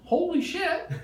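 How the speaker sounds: high, loud cry of alarm; shocked yell. Acted. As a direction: blurt it like a panicked doctor. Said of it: "The doctor's yelling".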